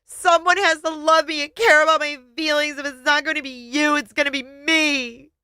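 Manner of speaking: wife crying back at her husband; through tears. Acted. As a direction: sob out the defiance. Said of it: "I said through the tears".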